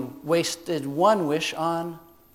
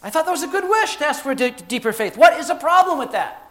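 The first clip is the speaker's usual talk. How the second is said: high, loud, acted objection; bewildered protest; 12 semitones higher, 8 dB louder than usual. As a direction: protest in disbelief, pitched high. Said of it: "And I'm like".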